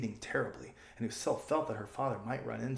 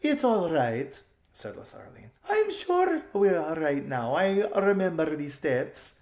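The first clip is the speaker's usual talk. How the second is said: high, loud, slow chirpy voice; silly girl reassuring; 5 semitones higher, 5 dB louder, slower than usual. Acted. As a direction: brush off the worry brightly.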